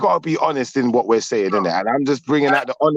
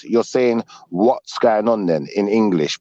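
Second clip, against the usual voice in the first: ordinary talk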